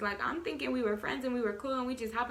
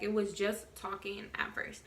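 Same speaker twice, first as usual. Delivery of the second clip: whisper; 4 dB quieter than usual. Real, not acted. Unvoiced whisper mid story.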